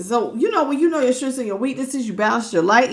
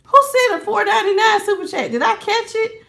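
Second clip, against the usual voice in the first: excited; 9 semitones higher than usual. Real, not acted. Searching for the superchat.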